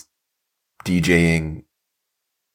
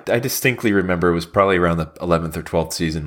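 ordinary delivery

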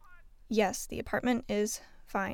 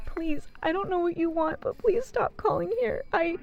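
pleading, voice climbing higher; frightened plea; 6 semitones higher than usual. Acted. Operator to caller, frightened rising plea. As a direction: beg in rising panic.

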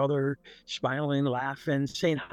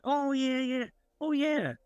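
higher, bright "oh yeah" as it suddenly clicks; sudden recollection; 11 semitones higher than usual. Retold or imitated. Son suddenly remembering to tell his mother, surprised recall. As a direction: blurt it out as the memory hits.

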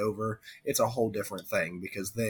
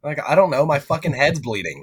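panicked victim; 5 semitones higher, 9 dB louder than usual. Pretend. Crash victim voiced.

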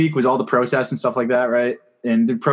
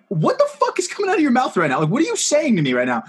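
high, disbelieving retold reaction; incredulous; 10 semitones higher than usual. To a flirty cop, stunned and incredulous. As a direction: act baffled at a weird compliment. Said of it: "And I was like".

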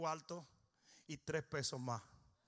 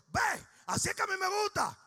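much higher, loud joyful cry; retold exclamation; 13 semitones higher, 10 dB louder than usual. Jesus answering the mother in the retold story, loud delighted exclamation. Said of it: "y Jesús dijo".

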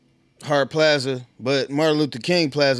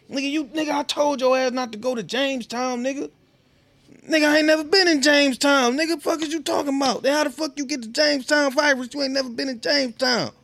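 very high-pitched mock argument; squabbling in a raised, indignant pitch; acted quarrel; 11 semitones higher than usual. Couple argue over a trip to Jamestown, high indignant bickering.

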